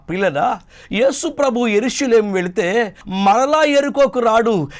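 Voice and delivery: ordinary talk, nothing marked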